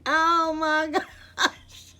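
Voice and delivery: high, drawn-out wail through laughter; crying laughing. Real, not acted. Vlogger laughing to tears at her own video, high laugh-cry. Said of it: "I got tears rolling down my face".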